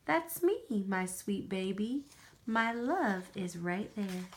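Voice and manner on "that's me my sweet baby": usual speaking voice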